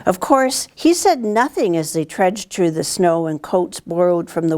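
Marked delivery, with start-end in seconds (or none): none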